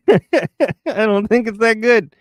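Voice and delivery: high, laugh-filled repeat. Real, not acted. Streamer cracking up over the conversion rate, laughing.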